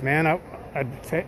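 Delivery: ordinary talk, nothing marked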